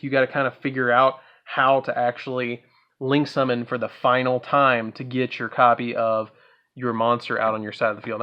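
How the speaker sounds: normal talking voice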